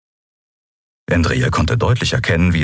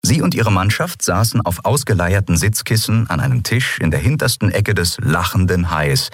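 usual speaking voice

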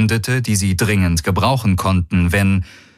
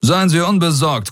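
higher, calmly superior warlord; cool warlord; 10 semitones higher than usual. Acted. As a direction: reassure him with icy politeness. Daraios reassures coolly.

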